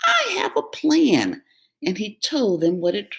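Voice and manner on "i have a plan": high, quick, pleased goat voice; proud of the plan; little goat sure of himself; clever little goat. Acted. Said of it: "feeling very smart indeed".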